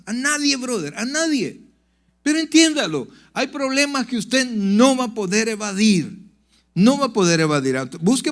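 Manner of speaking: high, loud pulpit preaching; full-force preaching. Acted. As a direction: preach it out hard to the congregation.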